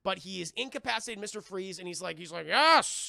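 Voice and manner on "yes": loud mock cheer of victory; triumphant yell. Villain gloats over the fallen hero, loud.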